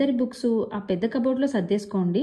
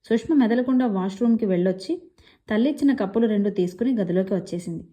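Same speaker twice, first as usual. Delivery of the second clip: plain reading voice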